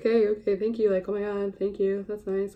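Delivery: mock polite thanks. Customer answering a gushing store associate, politely thanking her. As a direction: lightly act out an awkward thank-you.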